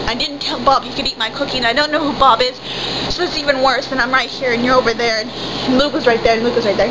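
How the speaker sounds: normal talking voice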